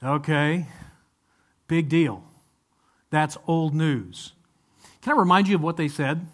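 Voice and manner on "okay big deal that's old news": slow, unimpressed shrug of a voice; mock ho-hum, drawn out; bored dismissive listener. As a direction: play the jaded listener who's heard it all. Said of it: "you'd say".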